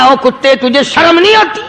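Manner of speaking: preacher voicing the angry saint scolding the dog. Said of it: "غصے میں آ کر کہنے لگا".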